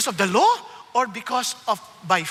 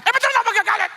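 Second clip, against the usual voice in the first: irritated retort; 10 semitones higher, 5 dB louder, faster than usual. Retold or imitated. Retold argument with wife.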